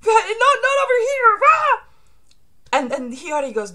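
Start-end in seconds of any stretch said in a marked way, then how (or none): 0.1-1.8 very high, loud frantic yelling; panicked shriek. Acted. Burning villain warding off flames, panicked high shout. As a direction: yell in panic, ending in a scream.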